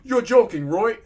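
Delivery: loud, high, incredulous yell; yelling in disbelief. A narrator's reading. Patient challenges the doctor on healing time, yelling. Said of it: "Sorry for yelling at you".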